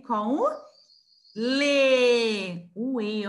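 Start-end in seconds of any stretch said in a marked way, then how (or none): 1.4-2.7 "lê" held long and loud on the E; teacher sounding out "lê", long final E; syllable demo. Shown as a demonstration. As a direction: stretch out "lê" so the vowel stands out. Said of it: "da sílaba lê".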